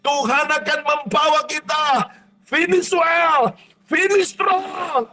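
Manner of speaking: shouted, soaring revival preaching; full-force preaching. Acted. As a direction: preach it like a climax and lift the whole room.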